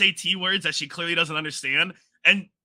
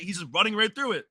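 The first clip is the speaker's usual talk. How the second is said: ordinary delivery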